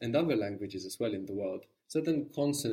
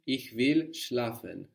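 normal talking voice

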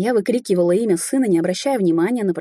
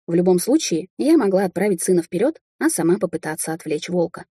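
ordinary delivery

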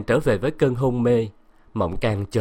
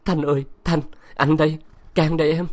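choked up; 5 semitones higher than usual. Acted. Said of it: "Cang nghẹn ngào quỳ xuống".

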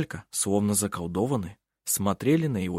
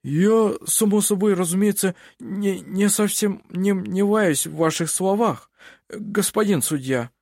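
a scared official fumbling his words; frightened mumbling; 9 semitones higher, 4 dB louder than usual. A narrator's reading. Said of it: "промямлил перепуганный Ленсман". (испуганно мямлит, запинаясь)

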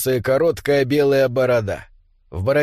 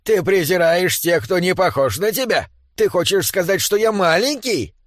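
higher-pitched affronted dwarf; indignant challenge; 4 semitones higher than usual. Acted. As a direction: challenge him, pitch climbing toward the end. Angry dwarf threatens David with pickaxe. (возмущённый наезд обиженного гнома)

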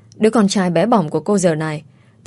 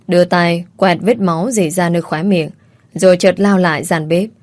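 ordinary delivery, nothing marked

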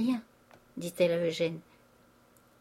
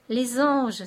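higher, louder cry of delight; rapturous exclamation; 4 semitones higher, 6 dB louder than usual. A narrator's reading. Old father moved by his daughters making up, exclaiming. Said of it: "s'écria le père Goriot".

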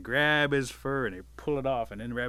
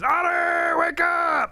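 high, full-force alarm shout; piercing, drawn-out wake-up yell; screaming; 12 dB louder, much slower than usual. A narrator's reading. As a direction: scream to jolt a sleeper awake. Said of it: "and he screams".

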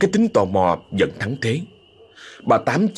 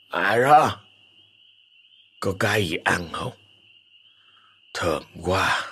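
slurred mushy voice; 3 semitones lower than usual. Acted. Possessed sick father asks for food, slurred and mushy. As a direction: mumble it thick and sluggish. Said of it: "Giọng ông nghe lờ lớ nhão nhẹt".

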